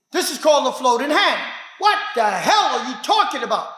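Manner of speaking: usual speaking voice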